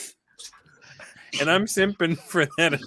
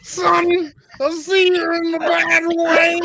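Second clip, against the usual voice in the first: much higher, slow hammed-up sitcom dad; Bill Cosby impression; 7 dB louder, slower than usual. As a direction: ham up a comic fatherly voice, slow and high. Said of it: "I did the Cosby voice all night".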